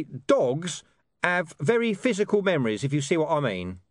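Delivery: high, gruff dog voice with a knowing air; a streetwise dog explaining things. Acted. Deep Bone speaking.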